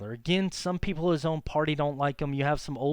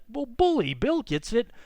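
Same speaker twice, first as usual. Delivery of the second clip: much higher, louder exclamation of hearty approval; Teddy delighted; 10 semitones higher, 5 dB louder than usual. Retold or imitated. Teacher imitating Teddy pleased by silent Taft, exuberant. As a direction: burst out pleased, like Roosevelt clapping a friend's back. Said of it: "Teddy would be like".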